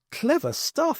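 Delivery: high admiring aside; a toff's impressed thought. Acted. Jeremy admiring the Doctor, high and impressed. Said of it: "thought Jeremy".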